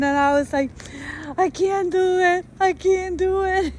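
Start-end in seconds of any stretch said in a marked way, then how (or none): 1.4-3.7 high-pitched mock-tearful wail; imitated crying. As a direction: re-enact a tearful meltdown, pitched up. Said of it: "I went inside the car crying".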